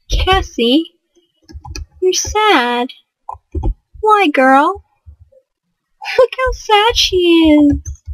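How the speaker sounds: high, cooing pity; high-pitched, drawn-out playful consoling; mock sympathy. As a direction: coo at a sad little character. Consoles the sad game character.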